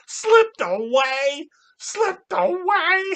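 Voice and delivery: high, slow outraged repetition; mock incredulous sneer. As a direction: drag it out, disbelieving. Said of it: "[name] mocking his words".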